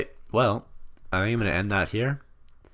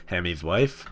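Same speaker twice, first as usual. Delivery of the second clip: laughing; 7 dB louder than usual. Real, not acted. Finds Hammy's family funny.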